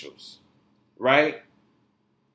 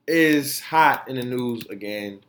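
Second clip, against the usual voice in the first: normal talking voice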